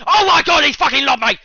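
high, very loud fury; real gamer rage outburst; outraged scream. Real, not acted. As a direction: yell in angry disbelief. Gets lobbed in game.

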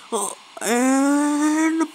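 ordinary talk, nothing marked

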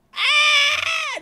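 high, loud scream; startled scream. Real, not acted. Player flung to outer space, startled scream. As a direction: scream as you are flung.

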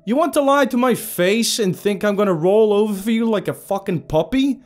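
screaming high and loud with rage; furious yell. A narrator's reading. Captive screaming defiance at the villain, furious yell. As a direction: yell it into his face, full fury. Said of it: "I yelled".

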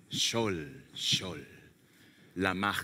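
low, hushed and tender Hebrew call; hushed voice of the Lord. Acted. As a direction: speak it like a gentle call from above.

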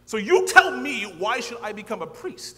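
loud defiant retort; defiant challenge. Retold or imitated. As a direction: throw the question back hard. Defiant argument with dean.

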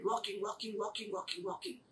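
very soft, trudging chant; fading repetition. A narrator's reading. Narrator tells Blackie's long walk, hushed fading repetition. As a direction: let the repeated word fade almost away.

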